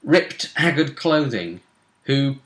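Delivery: ordinary talk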